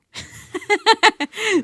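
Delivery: high loud burst of laughter; bright laugh. Real, not acted. Singer laughing at her own grim myth before the song, big laugh. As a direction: laugh it off at the story's end. Laughs after finishing story.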